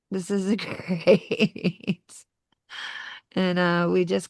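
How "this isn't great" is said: rueful laugh. Real, not acted. Admitting doubts after the promise.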